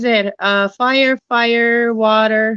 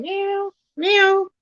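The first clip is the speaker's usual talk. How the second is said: high meow, meow; copying a cat she heard; imitated cat meow; 9 semitones higher than usual. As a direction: mimic the cat, pitched way up. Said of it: "heard a cat going".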